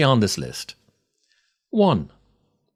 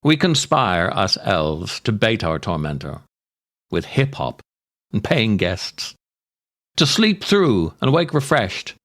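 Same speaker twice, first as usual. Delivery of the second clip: slow, dramatic recitation of a dark comic poem; poem reading. Acted. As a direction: savour each grim image like a storyteller. Reads the poem The Neighbours.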